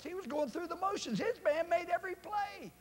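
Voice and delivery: high, loud indignant telling; outraged retelling. Retold or imitated. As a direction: let the anger at the lazy guard ring out. Angry retelling of lazy player.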